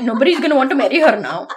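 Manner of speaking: mock-dire warning in an Indian accent. Gossips warning parents about their unmarried daughter, Indian accent. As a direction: put on an Indian-accented scolding. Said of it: "I am allowed to make an Indian accent".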